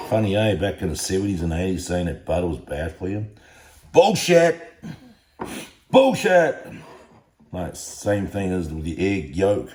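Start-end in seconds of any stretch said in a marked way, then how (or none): 3.9-6.7 much higher, louder shout; loud outburst. Real, not acted. Vlogger yelling at the claim that butter was bad. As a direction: blurt it out in indignant disbelief. Ranting at old diet advice.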